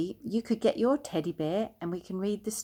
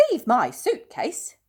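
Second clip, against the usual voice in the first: alarmed cry, a bit higher; alarmed exclaim; 6 semitones higher, 4 dB louder than usual. A narrator's reading. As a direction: bear exclaiming in sudden alarm. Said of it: "exclaimed Paddington in alarm".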